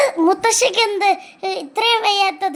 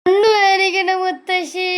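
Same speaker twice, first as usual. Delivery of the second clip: slow, crying complaint; tearful whine; slower than usual. Acted. Child telling grandmother her eyes hurt, tearful whine. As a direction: wail it out slowly to be comforted. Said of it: "എന്തിനാ മോള് കരയുന്നത്".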